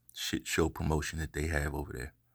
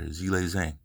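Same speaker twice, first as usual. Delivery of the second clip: normal talking voice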